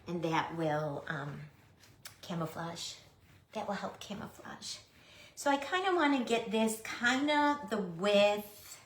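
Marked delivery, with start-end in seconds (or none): 3.5-4.8 whispered under the breath; quiet unvoiced aside. Real, not acted. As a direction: whisper it to yourself.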